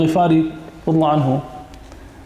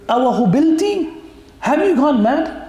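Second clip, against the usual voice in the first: astonished rebuke; 7 semitones higher, much faster than usual. Retold or imitated. Prophet rebukes grieving mother.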